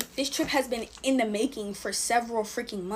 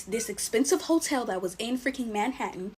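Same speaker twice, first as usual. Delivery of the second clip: ordinary talk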